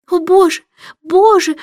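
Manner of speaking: high, loud lament from the queen; wailing queen. A narrator's reading. Queen wails in horror at the king's answer, high and loud. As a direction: wring your hands and wail it out. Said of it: "запричитала королева".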